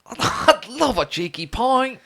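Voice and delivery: drawn-out, very high mock British; fake British accent. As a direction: squeal it slowly in cartoonish British. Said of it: "thought I was British".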